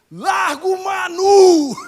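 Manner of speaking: very loud, high, slow commanding yell; booming shout. Retold or imitated. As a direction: roar the order to let go. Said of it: "só gritou assim".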